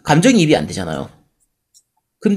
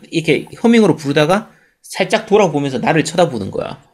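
ordinary talk

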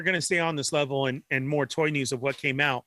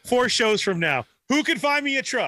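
higher, hammed-up calling out; mock desperate plea; 6 semitones higher than usual. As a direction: play a buyer frantically calling round. Mocking a future desperate truck buyer.

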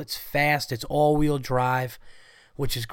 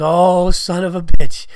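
loud, drawn-out teacher cursing; exasperated outburst; 5 semitones higher, 13 dB louder than usual. Retold or imitated. Teacher reacting to Blake's correction, loud exasperation. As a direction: act out an exasperated curse, slow and raised.

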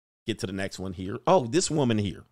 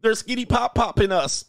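higher, mock alarmed voice; hammed-up sass turning to panic; mocking sassy woman; 6 semitones higher than usual. Mocking the woman who needs no man, high and panicky. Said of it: "they don't need no man, huh?".

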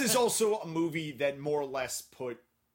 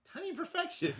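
playful quote pitched way up; high-pitched mimicry; 12 semitones higher than usual. Repeating a film line to amuse the co-host, high-pitched.

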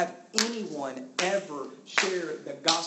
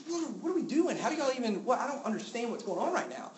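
baffled; 9 semitones higher than usual. Retold or imitated. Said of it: "I'm like".

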